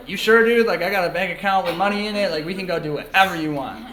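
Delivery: high, loud incredulous pitch; acted disbelief. Older brother with money offering little brother anything, incredulous. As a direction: push back loudly, urging a bigger choice. Acting out surprise at brother's modest choice.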